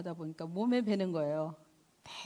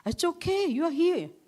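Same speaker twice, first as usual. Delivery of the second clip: high, loud, bright-eyed reassurance from a student; a student's high excited comfort; excited girl; 9 semitones higher, 7 dB louder, slower than usual. Retold or imitated. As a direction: cry it out high like an eager schoolgirl. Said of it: "학생 중에 하나 딱 그런 거야".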